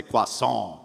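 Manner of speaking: nasal French "Croissant" with a French r; French nasality. Shown as a demonstration. Coach demos nasal resonance in a French accent. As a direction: say "Croissant" the French way, through the nose. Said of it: "think of French nasality".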